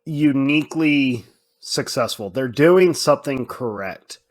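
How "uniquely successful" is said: usual speaking voice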